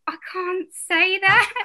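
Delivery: high, slow mock-helpless protest. Performer recalling dialect lessons, helpless protest. Retold struggle with dialect coach.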